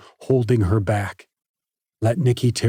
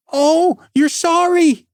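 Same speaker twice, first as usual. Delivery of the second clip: much higher and louder, mocking scorn; fuming; 6 dB louder, slower than usual. A narrator's reading. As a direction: throw his apology back at him. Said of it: "she fumed".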